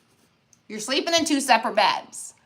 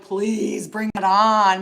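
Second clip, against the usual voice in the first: mock plea; 5 dB louder, much slower than usual. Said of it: "I'm like".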